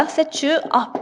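slow dictation. Shown as a demonstration. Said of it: "이렇게 얘기를 하면 돼요".

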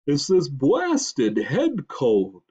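slow, loud, stuffed-up moose; deep grunting voice. A narrator's reading. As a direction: snort it through a head cold. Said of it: "snorted a deep grunting voice".

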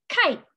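"Kite" as a single high, short beat; one-syllable beat. Shown as a demonstration. Teacher blinking along with the class, "Kite" as one beat. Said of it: "Let's do it again".

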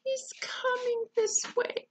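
high, half-whispered alarm; whispered fear. Acted. Eddie watching the bear come closer, whispered fear. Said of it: "whispered Eddie".

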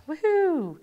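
high-pitched little woohoo; celebratory cheer. Real, not acted. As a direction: cheer as it finally works. Cheers as the astronaut appears.

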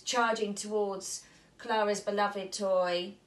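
usual speaking voice

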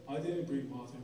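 low, quiet flat denial; deadpan denial. Retold or imitated. Dad denies bringing water, low quiet deadpan. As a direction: deny it flatly, eyes dead. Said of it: "and he goes".

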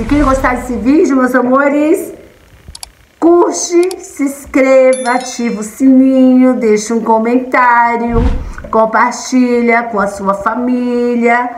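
bright sign-off pitch to subscribers; upbeat vlog outro. Acted. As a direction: sell the subscribe ask sweetly. Vlog sign-off call to action.

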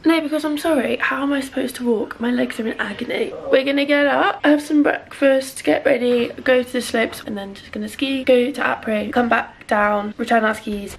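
ordinary delivery

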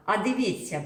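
ordinary delivery

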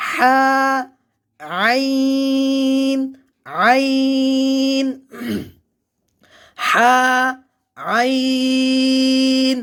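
হা and আইন from mid-throat, long held; slow letter drill, stretching হা and আইন; articulation demonstration. Shown as a demonstration. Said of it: "আমরা এখানে উচ্চারণ করি".

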